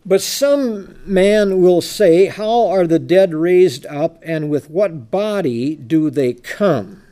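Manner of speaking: very slow, loud, measured verse reading; stately preacher reading from the Bible; scripture reading. Acted. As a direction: declaim it word by word. Reads scripture aloud.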